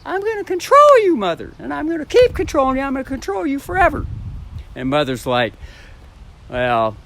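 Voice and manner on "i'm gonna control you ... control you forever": put-on very high, bratty child voice with a Japanese inflection. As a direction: throw a tantrum as a child, pitched way up, Japanese inflection. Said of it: "that's the tone".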